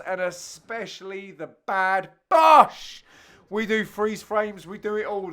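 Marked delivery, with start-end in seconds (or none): 2.3-3.0 high, loud call; commentary shout at a big shot; excited shout. Real, not acted.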